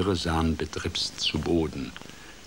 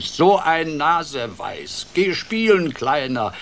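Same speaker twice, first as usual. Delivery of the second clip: big condescending fox voice, pitched up; booming mockery; 9 semitones higher, 7 dB louder than usual. A narrator's reading. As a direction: dismiss the little one with booming scorn. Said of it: "dröhnte der Fuchs".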